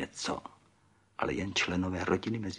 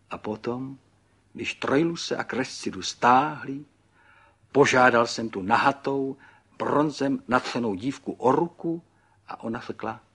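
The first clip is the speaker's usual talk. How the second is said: higher, emphatic, proud reminiscing; excited Pan Burgán; 5 semitones higher, 7 dB louder than usual. A narrator's reading. Pan Burgán recalls proposing to the bronzed girl, excited. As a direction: build eagerly toward the answer. Narrated figure tells his story.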